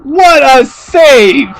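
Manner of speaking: slow, high, loud praise; amazed. Real, not acted. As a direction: savour the moment in awe. Praising the save.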